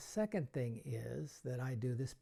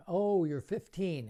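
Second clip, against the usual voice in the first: loud, slow mock astonishment; a magician pretending to mishear a kid's age; mock surprise; 5 semitones higher, 7 dB louder, slower than usual. Shown as a demonstration. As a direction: pretend you misheard, hammed up.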